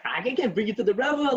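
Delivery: high, whiny protest; flustered husband. Retold or imitated. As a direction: squeak out a panicked refusal. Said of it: "he's like".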